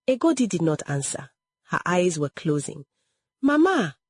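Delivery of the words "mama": high call with a falling end; urgent call. A narrator's reading. Daughter calls as her mother's eyes close, urgent call. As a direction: call out to mother, pitched well up. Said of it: "Adaize called again".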